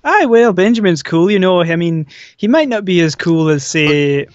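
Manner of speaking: in-character Scottish cat, lifted at the start; Benjamin. Acted. Cat character answers the fangirling host, in-character Scottish voice. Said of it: "you doing this voice in my ears".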